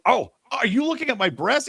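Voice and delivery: high retold accusation; mock accusing. Woman confronting man in the aisle, mock accusing.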